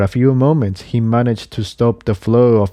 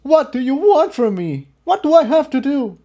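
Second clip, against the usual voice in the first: high, anguished, breaking plea; desperate scream. Acted. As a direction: beg for an answer in despair. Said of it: "Jigsaw was screaming at despair".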